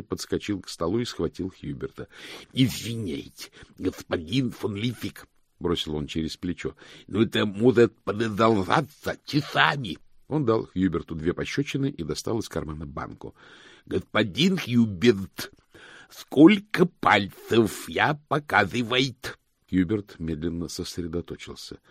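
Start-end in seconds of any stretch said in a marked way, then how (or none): 2.3-5.3 slow, odd-timbred servant with thick lisped diction; servant Igor. Acted. Igor excusing himself to the master, lisping servant voice. Igor's voice in narration. 6.9-10.0 loud, higher lisping henchman voice; servant Igor. Acted. Igor over his shoulder before slapping Hubert, lisping servant voice. 13.6-19.4 very slow, loud, higher henchman voice; servant Igor. Acted. Igor testing dazed Hubert, slow lisping servant voice.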